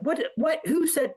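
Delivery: high, incredulous mock drill instructor; imitating a drill instructor cutting him off. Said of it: "And he's like".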